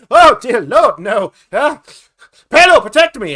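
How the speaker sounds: startled shriek. Acted. Halfling shrieks at the door-openers, panicked. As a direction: leap back in fright and cry out.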